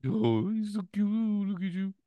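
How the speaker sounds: very high cooing pet-owner voice; baby talk to a pet. Pretend. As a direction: coo like praising a puppy.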